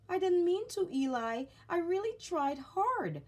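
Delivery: pleading little puppet. Acted. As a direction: beg him not to be angry. Punchinello pleads defensively.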